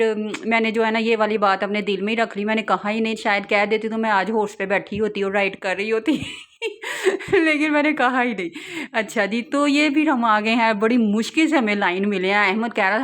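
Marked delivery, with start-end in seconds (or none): 6.1-8.5 laughing. Real, not acted. Laughs at her unspoken wish.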